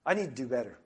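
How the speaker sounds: ordinary talk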